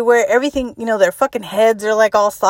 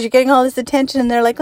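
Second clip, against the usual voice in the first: ordinary talk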